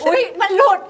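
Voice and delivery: laughing oops. Real, not acted. Slip of tongue, laughing.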